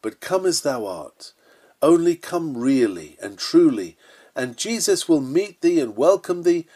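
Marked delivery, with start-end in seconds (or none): none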